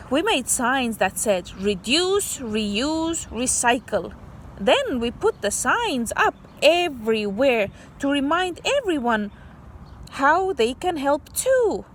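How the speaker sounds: put-on bright read-aloud voice for kids; story reading. Teacher reading a story to kids, storybook voice. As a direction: read the picture book to children.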